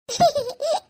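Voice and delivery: high tittering giggle; silly high laugh. Acted. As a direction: giggle in quick little bursts. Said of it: "కాకిరెట్టేసినట్టు".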